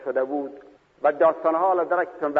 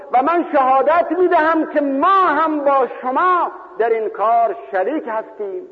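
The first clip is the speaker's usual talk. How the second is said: fervent sermon cry; fervent proclamation; 11 semitones higher, 5 dB louder than usual. Retold or imitated. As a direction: proclaim it to the martyrs. Jabir declares partnership.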